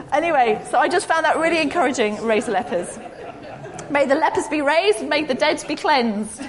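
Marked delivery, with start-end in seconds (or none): 3.9-6.4 playful jumbled proclamation; deliberately muddle the verse for a laugh; mock-solemn mixed-up blessing; mock mixed-up scripture. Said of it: "I've got them mixed up".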